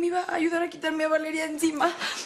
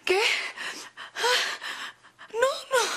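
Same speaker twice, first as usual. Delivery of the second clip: higher tearful begging; crying plea; 6 semitones higher than usual. Acted. Begging Alma for help while crying. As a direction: plead with her through sobs. Begging godmother through tears.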